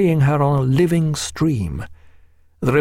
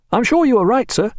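put-on higher, eager young lieutenant; lightly voiced keen young officer; 11 semitones higher than usual. A narrator's reading. Reed voiced.

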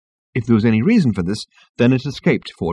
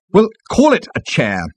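high, testy retort; 10 semitones higher, 3 dB louder than usual. A narrator's reading. Management consultant answers Ford, testily. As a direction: answer with irritation. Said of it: "he said testily".

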